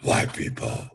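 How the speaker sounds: laughing breathy threat; menacing whisper. Retold or imitated. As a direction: whisper it like a villain. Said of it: "it's like".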